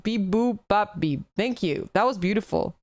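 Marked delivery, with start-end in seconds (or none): 0.0-1.2 robot hymn. Pretend. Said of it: "This is a favorite hymn of my mother".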